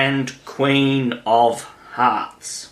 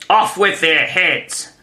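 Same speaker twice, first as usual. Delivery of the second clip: higher, forceful command; exclaimed death sentence, pitch raised; shouted order; 6 semitones higher than usual. A narrator's reading. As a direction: order it like an enraged queen. Queen orders an execution.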